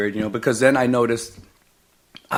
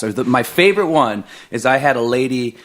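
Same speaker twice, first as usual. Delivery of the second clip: ordinary talk, nothing marked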